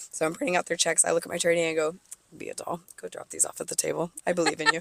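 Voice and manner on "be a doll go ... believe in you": sweet aside. Retold or imitated. Sending trainee away sweetly.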